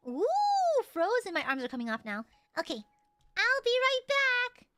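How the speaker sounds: ordinary delivery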